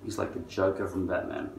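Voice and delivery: normal talking voice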